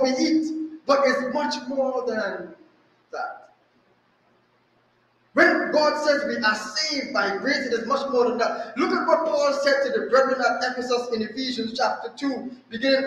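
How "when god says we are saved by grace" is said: ordinary delivery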